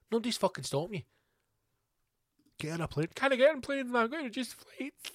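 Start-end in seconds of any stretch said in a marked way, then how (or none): none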